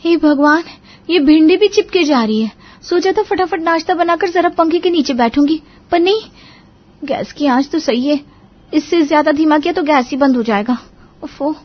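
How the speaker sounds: put-on high, loud young bahu voice; high-pitched, flustered kitchen grumble; exasperated daughter-in-law. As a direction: fret over the cooking in a high voice. Bahu frets in hot kitchen.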